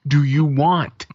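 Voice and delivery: put-on loud exasperated snap at the kid; retold meltdown snap. Dad losing it at his nagging daughter, angry slow snap. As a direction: bark it out, dragging each word slowly. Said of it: "I had one meltdown".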